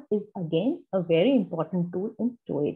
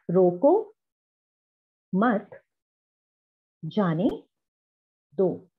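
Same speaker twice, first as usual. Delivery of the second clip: "रोको मत, जाने दो" one word at a time; long pause after each word of "रोको मत जाने दो"; palabra por palabra; slower than usual. Shown as a demonstration. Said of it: "pause लेकर बोलने का".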